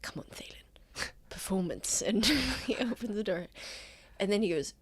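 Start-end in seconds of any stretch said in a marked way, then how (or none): 0.0-2.0 whispering. Acted. Psyching himself up in whisper.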